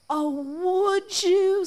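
feigned eager delight. Acted. Rabbit delighted, faking weakness.

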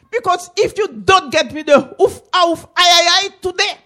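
high, loud threatening shout; threatening auntie. Acted. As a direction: yell the threat at the boy.

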